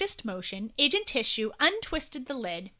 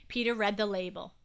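usual speaking voice

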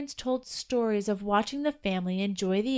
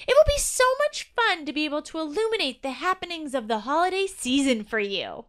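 a lightly voiced eager elf; 6 semitones higher than usual. A narrator's reading. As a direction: promise it gladly. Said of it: "said E excitedly".

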